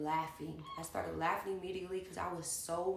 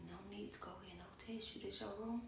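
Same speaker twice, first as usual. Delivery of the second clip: whispery, quick mock-seductive murmur; put-on sexy voice; 8 dB quieter than usual. Woman asking about the bedroom, whispered mock sexy voice. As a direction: imitate her coming on to you, very softly. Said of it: "put her little sexy voice on".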